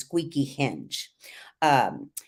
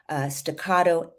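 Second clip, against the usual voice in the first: ordinary talk, nothing marked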